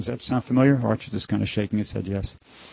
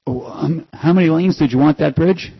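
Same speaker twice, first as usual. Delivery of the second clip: higher, louder genie voice; 7 semitones higher, 6 dB louder than usual. Acted. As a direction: genie snapping back the punchline. Genie punchline in joke.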